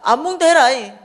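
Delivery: high, loud angry shout; acted-out outburst of anger; furious refusal. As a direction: yell the refusal back in a temper. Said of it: "너무 화가 나는 거예요".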